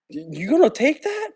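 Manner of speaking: high, mocking teacher voice egging on a fight; goading teacher.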